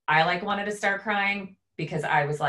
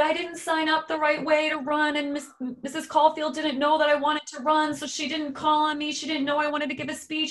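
a lightly voiced, higher upset boy; imitating a sobbing kid; 11 semitones higher than usual. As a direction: mimic a little boy's tearful complaint.

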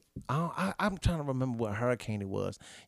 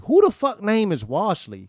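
loud, slow, high indignation; man objects to being misnamed Washley, incredulous; 4 semitones higher, 8 dB louder than usual. Real, not acted. Push it out in drawn-out disbelief.

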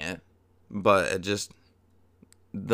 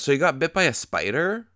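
imitating Ned; 6 semitones higher than usual. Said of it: "and Ned goes".

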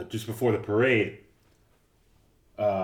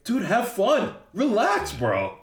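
much higher, louder coaxing; pitched-up playful plea; mock exasperated urging; 11 semitones higher, 4 dB louder, slower than usual. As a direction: urge an uptight buddy to loosen up. Voices urging a friend to loosen up.

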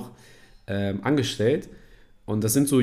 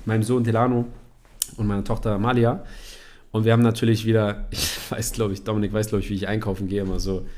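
usual speaking voice

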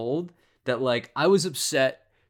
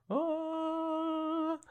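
high yelp; 8 dB quieter than usual. Real, not acted. Shocked at near slip.

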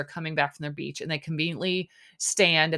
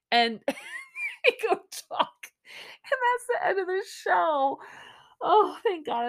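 laughing; 12 semitones higher than usual. Real, not acted. Laughing at the episode ending.

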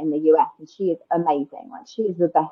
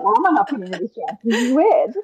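higher, amused and baffled; mock bewilderment; 5 semitones higher, 3 dB louder than usual. Guest recalls not feeling stressed all season, mock bewilderment. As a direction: act out puzzled disbelief at yourself. Acts out her puzzled past self.

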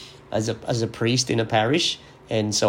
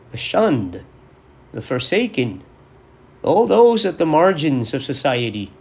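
raised, higher preaching voice with rising urgency; 4 semitones higher, 4 dB louder than usual. Acted. Priest preaching to his listeners on Christ the King, earnest and raised. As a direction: preach it, lifting the key points. Homily continues.

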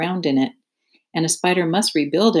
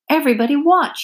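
much higher, louder eager cry; cried out; 9 semitones higher, 3 dB louder than usual. Acted. Wilbur before jumping, crying out to the watchers. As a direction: announce it proudly to everyone. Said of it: "he cried".

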